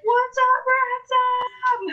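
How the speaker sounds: high mock holler; retold excited greeting. Host retelling her greeting to Ransom, high excited call. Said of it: "I was like".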